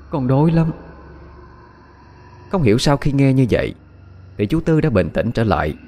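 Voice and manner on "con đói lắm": distant hungry ghost. Acted. The drowned girl's ghost begs the couple for food, higher wail. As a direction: plead from far away like a hungry spirit. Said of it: "nghe xa xăm vô cùng".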